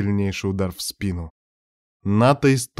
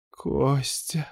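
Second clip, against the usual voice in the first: soft, strained high moan; groaned call; 4 semitones higher, 7 dB quieter than usual. A narrator's reading. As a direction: moan the name softly, pitched up. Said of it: "простонал он тут же".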